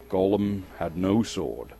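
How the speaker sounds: ordinary delivery, nothing marked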